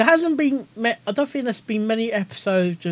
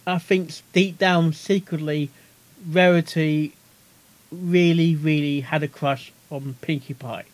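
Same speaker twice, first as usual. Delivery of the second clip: usual speaking voice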